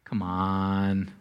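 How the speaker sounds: very low, soft coaxing; tempting voice of culture. Acted. Culture tempting believers, low soft coaxing. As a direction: coax temptingly, dropped way down low.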